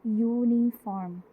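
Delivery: यूनिफॉर्म said slowly with a clear Y glide at the start; slow sounded out. Shown as a demonstration. Teacher to class, slowly sounding out a word. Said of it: "इसकी speech sound check करूँ".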